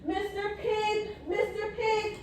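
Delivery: high soft sing-song call; calling out sweetly. Acted. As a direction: coax the pig out gently. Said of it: "I called".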